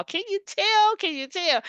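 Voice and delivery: high, excited sing-out. Real, not acted. Host telling y'all how excited she is, excited. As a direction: ask it with delighted high pitch. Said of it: "I'm so excited".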